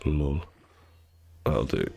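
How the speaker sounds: usual speaking voice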